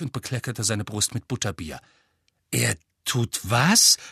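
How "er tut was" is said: prustend, langsam, verschluckt; spit take. A narrator's reading. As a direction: Butterbier ausprusten vor Empörung. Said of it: "prustete er empört". (empört prustend, mit vollem Mund)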